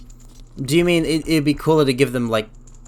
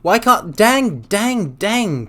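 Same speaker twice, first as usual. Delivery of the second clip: frustrated; 7 semitones higher, 3 dB louder, much slower than usual. Real, not acted. Frustrated outburst at game.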